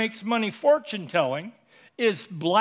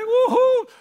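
high, loud whoop of joy; a young man whooping with delight; thrilled whoop; 7 dB louder than usual. Acted. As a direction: let out a giddy cheer. Young Silas thrilled at invitation.

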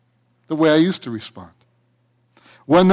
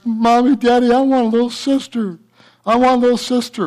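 put-on higher child's pleading voice; little boy voice; 7 semitones higher than usual. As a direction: beg like a small boy wanting a sister. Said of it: "He kept telling his parents".

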